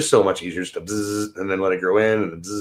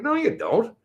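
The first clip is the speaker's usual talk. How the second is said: retelling own reply; 6 semitones higher than usual. Retold or imitated.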